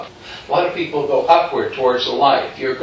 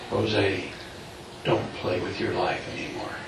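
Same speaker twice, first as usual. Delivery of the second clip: very soft, low voice of the figure; hushed, low and solemn; gentle admonition; 7 semitones lower, 12 dB quieter than usual. Retold or imitated. Figure in the light warns Jose, gentle admonition.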